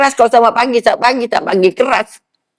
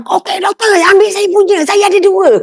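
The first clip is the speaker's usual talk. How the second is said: higher, eager offer from the man in the queue; eager helpful stranger; 9 semitones higher than usual. Retold or imitated. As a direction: chirp it up like a keen helper offering his card. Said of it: "Orang belakang".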